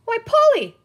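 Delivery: high, loud burst of surprise; delighted exclamation. A narrator's reading. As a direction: exclaim it, pitch leaping way up. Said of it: "Penny exclaimed".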